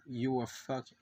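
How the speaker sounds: normal talking voice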